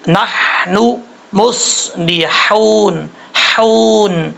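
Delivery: نحن مصلحون slowly with clear h and long oo; measured tajweed drill; practice demo. Shown as a demonstration. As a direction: stretch each syllable, repeat a held حون. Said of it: "practice کرنی ہے".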